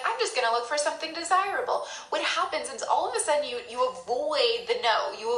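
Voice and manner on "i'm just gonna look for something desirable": higher, quick, breezy brush-off; breezy dodge. Pretend. Ignoring the gut and chirpily looking elsewhere. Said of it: "you're like".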